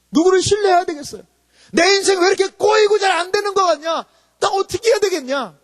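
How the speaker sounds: high, forceful rhetorical questions; impassioned preaching. Real, not acted. Preacher voicing a struggling person's questions, impassioned. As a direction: cry out the questions to the hall.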